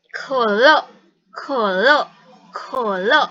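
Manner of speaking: "Kolo" with a dip and rise, loud; model pronunciation. Shown as a demonstration. Teacher models for the student to copy, "Kolo" loud with dipping, rising tone. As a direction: say "Kolo" with a puffed k, tone dipping then climbing. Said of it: "aku baca duluan, kamu ikutin".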